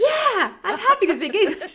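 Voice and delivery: imitating a stranger relieved to hear English; relieved gush. Relieved stranger voiced in story.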